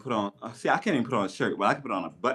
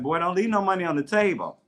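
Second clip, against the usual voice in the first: friend scolding; 8 semitones higher than usual. Retold or imitated. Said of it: "He's like".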